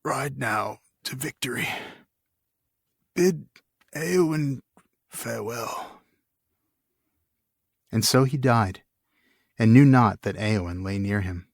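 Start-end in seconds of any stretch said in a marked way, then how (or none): 0.0-6.0 soft, faint king's farewell, higher; a dying king's last breath of words. Acted. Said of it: "he said".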